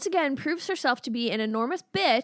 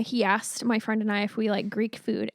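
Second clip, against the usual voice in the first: usual speaking voice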